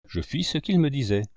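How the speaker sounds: usual speaking voice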